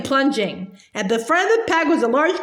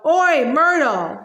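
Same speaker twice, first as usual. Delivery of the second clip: drawn-out, mild bellow; 3 semitones higher, slower than usual. A narrator's reading. Peeves calls Myrtle over, mildly bellowing. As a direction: call out slowly, a restrained bellow. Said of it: "took a deep breath and bellowed".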